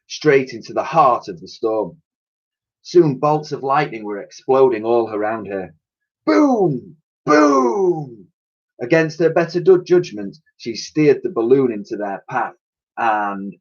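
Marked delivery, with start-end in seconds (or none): 6.3-8.3 thunder booms. A narrator's reading. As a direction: boom like thunder. Narrator voices thunder.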